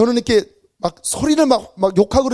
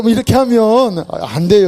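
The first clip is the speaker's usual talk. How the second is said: ordinary delivery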